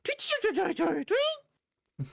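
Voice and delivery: high, loud chirping creature cry; Pokemon cry. Pretend. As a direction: chirp like a tiny bird Pokemon. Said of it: "mimic Pokemon cries".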